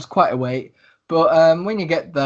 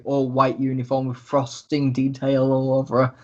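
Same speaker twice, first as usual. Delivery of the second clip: ordinary talk, nothing marked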